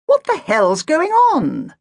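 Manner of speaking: high, loud and slow outraged demand; angry demand. Acted. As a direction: make the youth answer, furious. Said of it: "demanded Agatha".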